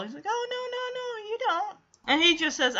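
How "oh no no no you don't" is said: high reassuring. Retold or imitated. Husband reassuring wife about looks.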